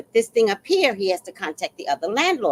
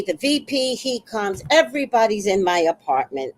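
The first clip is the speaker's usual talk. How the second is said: ordinary delivery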